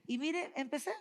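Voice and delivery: real amused laughter; laughing. Real, not acted. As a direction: laugh into the next words. Laughs at her prayer anecdote.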